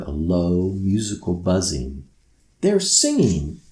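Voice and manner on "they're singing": high, delighted cry; excited exclaim. Acted. Girl hears the night children sing, high and thrilled. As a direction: burst out in wonder. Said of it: "Thompson exclaimed".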